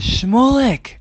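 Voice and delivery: high, loud cry of welcome; joyful shout. Acted. The Baal Shem Tov spotting Shmulek, delighted shout. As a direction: hail a long-awaited guest by name.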